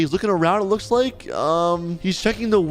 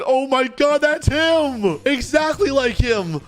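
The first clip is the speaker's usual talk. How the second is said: high, excited outcry of a witness; shocked recognition; 7 semitones higher, slower than usual. Acted. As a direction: act out a startled witness, pitch way up. Voices witness recognising the culprit.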